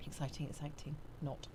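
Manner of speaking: understated sarcasm, then undercut it; sarcastic. Pretend. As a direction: murmur it as if unconvinced. Sarcasm about own dull job.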